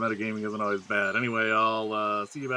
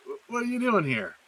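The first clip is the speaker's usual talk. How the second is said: startled; 10 semitones higher than usual. Acted. Startled to see old player.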